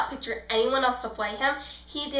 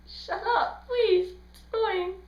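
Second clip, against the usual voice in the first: mock annoyed plea; 9 semitones higher than usual. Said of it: "And I was like".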